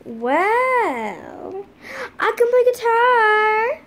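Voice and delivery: high, loud sobbing words; crying child. Pretend. Student sharing in class while crying loudly. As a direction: blubber it through tears.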